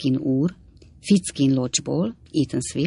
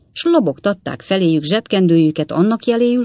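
plain reading voice

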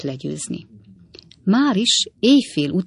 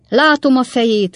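loud, higher furious cry; angry shout; 8 semitones higher, 6 dB louder than usual. A narrator's reading. The angry old man shouting as he spots Jingle, angry. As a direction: bellow it at the fleeing coach. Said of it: "kiáltotta a dühös öreg úr".

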